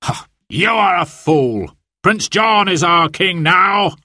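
scornful sheriff. Acted. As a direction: sneer at the lady. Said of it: "cried the sheriff".

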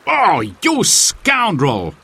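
slow, drawn-out outraged scoundrel; mock-angry storybook outburst; indignant cry. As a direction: stretch it out in hammed-up indignation. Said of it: "cried Robin".

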